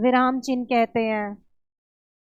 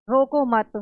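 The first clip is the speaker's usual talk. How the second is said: "रोको मत" joined, falling on "मत"; रोको मत as one phrase. Shown as a demonstration. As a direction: say "रोको मत" as one firm unit, then stop. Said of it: "इसमें बोला गया है कि".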